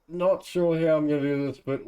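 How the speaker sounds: usual speaking voice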